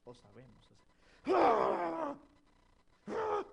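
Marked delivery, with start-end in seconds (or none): 1.3-3.4 loud, high angry yell, hammed up; loud mock yell. Husband raising his voice at his wife, loud mock yell. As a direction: bark it out like a husband losing his temper.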